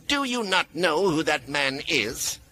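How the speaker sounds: high, stern woman's demand. A narrator's reading. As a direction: demand it of the guards. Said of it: "Mia demanded".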